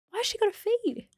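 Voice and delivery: high, soft confused voice; genuinely puzzled; baffled. Retold or imitated. New mum baffled that baby needs feeding.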